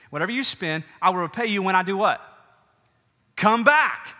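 preached promise. Acted. Preacher voicing the Samaritan's promise.

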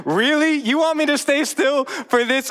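high, loud disbelieving wife; incredulous wife. Retold or imitated. Pastor voices wife told to hold still in poop. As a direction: voice the wife's tired disbelief, pitched well up. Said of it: "That's Hannah's face there of like".

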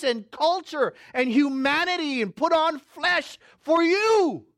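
loud, high, ringing pulpit preaching that slows on the key phrases; preaching at full force. Acted. Pastor preaching to his congregation about the cultural gap, full-force preaching. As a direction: preach it out to the back pews, climbing.

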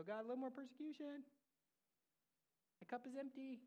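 high, wheedling pretend request; mock pleading. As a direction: ask sweetly in a put-on high voice.

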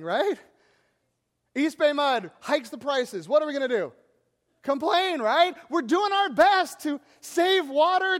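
loud, driving preacher voice from the pulpit; preaching at full force. Acted. Pastor rousing the congregation about complaining, preaching at full force. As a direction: hammer each line home to the congregation. Impassioned sermon climax.

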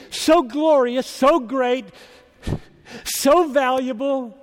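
high, loud, building praise; ringing pulpit fervor; impassioned preaching. Real, not acted. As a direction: punch each "so" like a preacher exalting. Preacher piling up God's greatness.

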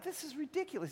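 high, incredulous retold exasperation; exasperated dad. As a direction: throw up your hands at the fuss. Exasperated father at crying son.

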